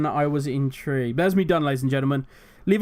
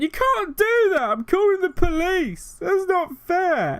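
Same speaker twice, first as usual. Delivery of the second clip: high, loud, slow blubbering whine; mock crying; 12 semitones higher, 3 dB louder, slower than usual. Drunk bully who got punched, mock crying to the bar. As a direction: wail it like a sore loser running to tell. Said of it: "starts crying, going".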